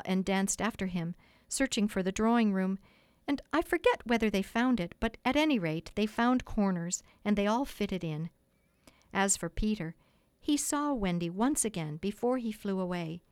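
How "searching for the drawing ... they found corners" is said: ordinary delivery, nothing marked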